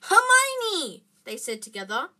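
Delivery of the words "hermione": very high, loud cry of alarm; panicked, piercing call; horrified shout. A narrator's reading. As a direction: shout the name in horror. Boys realise Hermione is in danger.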